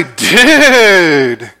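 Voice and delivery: long, drawn-out stretched dude; excited dude. Shown as a demonstration. Preacher shows dude meaning excitement, stretched and eager. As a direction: let it swell with excitement. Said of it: "Or to say you're excited, you might say".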